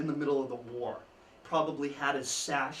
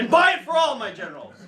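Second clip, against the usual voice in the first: much louder, higher triumphant retort; Lincoln's punchline; 9 semitones higher, 13 dB louder, faster than usual. Retold or imitated. Lincoln shutting down jealous generals, loud triumphant punchline. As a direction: deliver the comeback with a big flourish. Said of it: "He would say".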